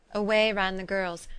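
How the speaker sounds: ordinary delivery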